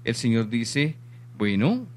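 normal talking voice

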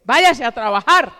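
loud, curt mother's order; harsh rejection. Retold or imitated. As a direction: send the girl off with a loud command. Mother rejects her pleading daughter. (orden dura y en voz alta)

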